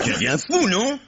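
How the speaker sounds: aigu, incrédule; s'étonne avec éclat; stupéfait. Acted. Georges choqué par l'insulte. (Georges stupéfait devant l'ivrogne, voix qui monte)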